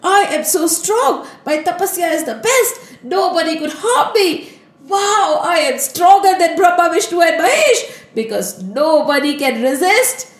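high-pitched, elated self-congratulation; overjoyed boasting. A narrator's reading. Narad Muni marvels at his own power, overjoyed. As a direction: crow with delight at your own strength. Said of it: "He was so happy".

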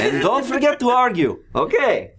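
loud high festive barker call; booming sing song welcome. Acted. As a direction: boom it out like a carnival host. Barker keeps calling.

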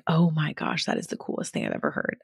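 softly voiced awe of an imagined child; awed little girl. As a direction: drop to a quick, awed undertone. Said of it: "she would be like".

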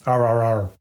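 R R R growled like a pirate. Pretend. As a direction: do a pirate's arrr. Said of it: "you just sound like a pirate".

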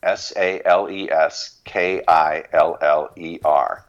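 letter by letter, slowly; spelled out slowly. Shown as a demonstration. Spelling the web address for listeners, slowly. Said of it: "sales killers".

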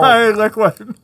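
talking through a mouthful of candy; words garbled round a full mouth. Real, not acted. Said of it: "put too much in my mouth".

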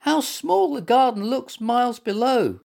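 high-pitched small creature squeak; squeaky little animal. A narrator's reading. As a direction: squeak it out in wonder. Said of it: "squeaked Little Mutt".

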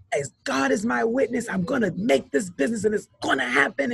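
imitating a defiant vow, pitched high; retold high-pitched, determined declaration; impassioned conviction. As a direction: swear it with total conviction, quoting her. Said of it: "so much conviction in her voice".

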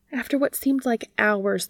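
ordinary delivery

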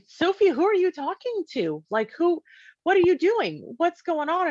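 put-on frantic mom calling to her child; freaking out. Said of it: "And I'm, like, freaking out".

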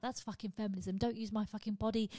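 normal talking voice

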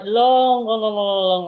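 LONG stretched out over and over. Real, not acted. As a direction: draw out each "long", letting it tail away. Stresses how far back it goes.

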